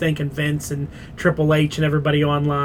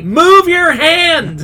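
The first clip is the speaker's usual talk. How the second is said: high, slow shouted warning; yelling at TV; 13 semitones higher, 8 dB louder, much slower than usual. Retold or imitated. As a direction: yell it at the screen. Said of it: "I'm yelling at the T V".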